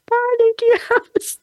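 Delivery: very high, drawn-out mock holler; imitated yell. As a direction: call the name slowly, high-pitched, as if across a stage. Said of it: "he yells out my name".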